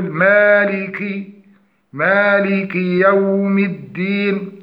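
slow مالك يوم الدين with light long a; correct recitation. Shown as a demonstration. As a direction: recite مالك with a short final i, unhurried. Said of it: "فنقول". (تلاوة بطيئة بميم مرققة)

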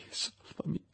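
unvoiced plea on salvami; desperate whisper. Victor begging Clerval again, whispered. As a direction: beg faintly in terror.

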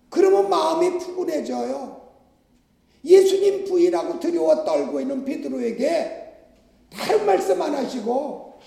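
normal talking voice

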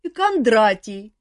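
put-on sleepy drunk. A narrator's reading. Another drunk wakes.

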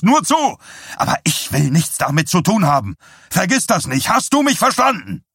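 starting firm, climbing to a high shout; building angry tirade. A narrator's reading. Husband laying down the law to his wife, mounting fury. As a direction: build from scorn to furious shouting.

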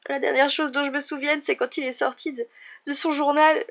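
put-on distraught girl's confession, high and loud; tearful Ginny. A narrator's reading. Ginny confesses to Harry what Jedusor forced her to do, tearful and distraught. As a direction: pour it out through sobs, high-pitched and halting. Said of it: "Elle fut secouée d'un sanglot".

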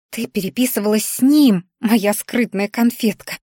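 ordinary delivery, nothing marked

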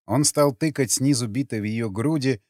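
ordinary delivery

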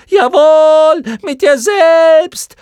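very high, loud, slow mocking shriek; screaming chorus. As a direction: jeer it back together. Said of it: "schrien die Schlamuffen im Chor". (Mottenchor schreit höhnisch und sehr hoch)

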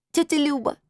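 put-on high, affectionate little-girl voice; растроганная девочка. A narrator's reading. Girl hugs aunt, delighted. (высоким ласковым детским голосом)

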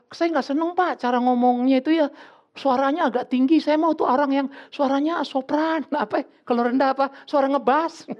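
lightly voiced fussy child listing complaints; picky child. (anak rewel mengeluh, suara tinggi)

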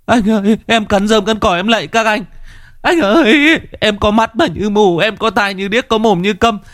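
very high, desperate pleading; a tied-up gambler begging the gang boss for mercy; begging for his life. Acted. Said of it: "có tiếng van xin".

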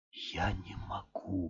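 slow, soft whisper of despair; hushed broken murmur; whispered mumble. A narrator's reading. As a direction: barely breathe it out. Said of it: "бормотал он".

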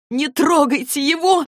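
high, loud desperate scream. A narrator's reading. Clary screaming at Valentine kicking wounded Luke. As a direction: yell it to stop them hurting him. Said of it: "заорала Клэри".

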